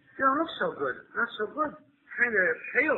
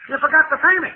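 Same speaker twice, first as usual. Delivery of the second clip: much louder and higher, yelling after him; angry shout; 4 semitones higher, 11 dB louder than usual. Acted. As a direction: holler at the guy skipping the fare. Cabby yells after fleeing fare.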